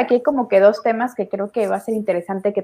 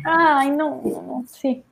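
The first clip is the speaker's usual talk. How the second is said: teary high laughing "ay, mira", much higher; real, choked-up laugh of being touched; host moved by the story, teary laugh; moved to tears; 6 semitones higher, slower than usual. Real, not acted. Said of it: "soy muy, muy llorona".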